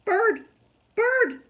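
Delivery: high, loud panicked screech; a girl screaming with a bird on her head. Acted. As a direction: scream for help at the top of your voice. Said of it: "I screeched".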